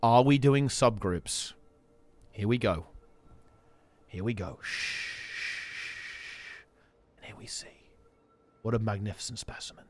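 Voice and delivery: hushed nature documentary narrator; wildlife watching whisper. Pretend. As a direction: whisper like you might scare the animals. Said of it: "We were full safari mode there".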